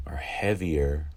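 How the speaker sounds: slow whisper on "are heavier". Acted. Making the legs feel heavy, slow whisper. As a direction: breathe the words so they sink.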